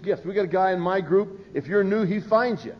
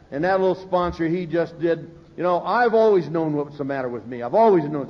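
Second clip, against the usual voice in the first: ordinary talk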